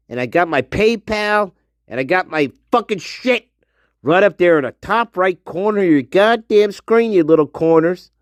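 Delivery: normal talking voice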